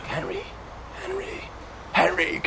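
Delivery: high, thin, soft calls of Henry; a creaky ancient rabbi piping up to the king, soft and very high; frail old man calling. Acted. Ancient rabbi calls out to King Henry on his throne, high and frail.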